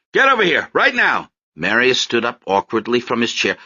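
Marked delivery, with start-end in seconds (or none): 0.1-1.3 higher, louder order; father's higher, louder command; angry command. Acted. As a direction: snap at her to come at once. Father ordering child away.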